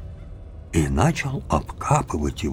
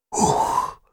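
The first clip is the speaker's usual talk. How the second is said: exhausted breath. Acted. After pulling it out.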